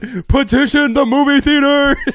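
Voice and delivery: high, loud protest yell; mock rallying shout. Host urging fans to demand a screening, mock rallying shout. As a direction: holler it like a pretend agitator.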